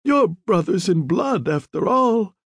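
high, teasing insinuation; lightly mocking dying man. A narrator's reading. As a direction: needle him slyly, not really serious. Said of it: "as if he were mocking the Don".

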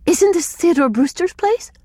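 high, gasped disbelief; startled, high-pitched question. A narrator's reading. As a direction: gasp it out high, bewildered. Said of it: "Aloud she gasped".

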